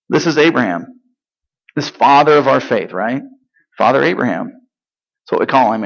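normal talking voice

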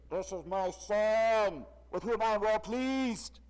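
slow, loud heavenly voice; booming voice of God. Shown as a demonstration. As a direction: boom it down from the sky. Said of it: "That wasn't at all like it".

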